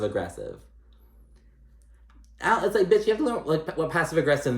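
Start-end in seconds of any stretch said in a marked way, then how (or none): 2.4-4.6 exasperated scolding. Real, not acted. Scolding Rinna as viewer.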